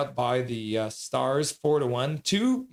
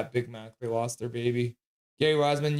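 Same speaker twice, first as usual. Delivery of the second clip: normal talking voice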